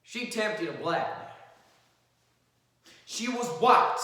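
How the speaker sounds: earnest, rising closing argument; courtroom lawyer. Acted. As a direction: plead with the jury, building. Atticus continues the argument.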